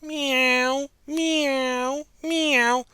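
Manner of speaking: high sad meows; sighing kitten mews. Acted. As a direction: mew sadly like sorry kittens. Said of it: "they began to sigh".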